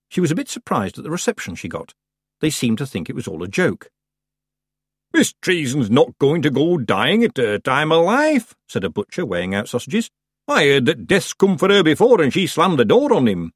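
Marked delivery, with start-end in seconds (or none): 5.1-13.5 butcher. A narrator's reading. Butcher scoffing at the girl while weighing sausages. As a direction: laugh off the idea.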